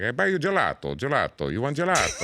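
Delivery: sleazy Italian man flirting; Italian accent. Retold or imitated. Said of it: "talking like that ... Italian guy".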